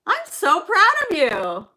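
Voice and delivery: high, slow, drawn-out praise; gushing pride. Real, not acted. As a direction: gush proudly, stretching the words. Praising child for right answer.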